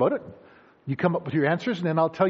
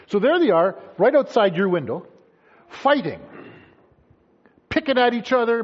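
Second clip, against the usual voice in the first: ordinary delivery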